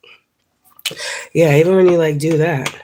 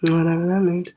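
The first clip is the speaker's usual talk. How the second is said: ordinary talk, nothing marked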